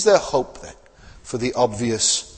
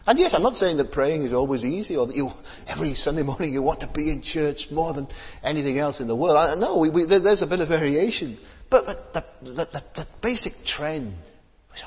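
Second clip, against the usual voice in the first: ordinary talk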